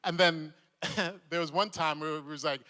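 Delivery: ordinary talk, nothing marked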